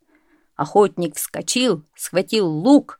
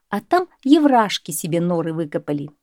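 plain reading voice

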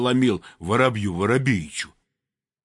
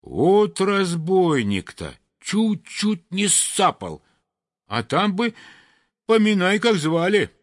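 sparrow voice; 8 semitones higher than usual. Acted. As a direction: gasp out a narrow escape. Sparrow complaining after chase. (высоким голосом воробья, жалуясь)

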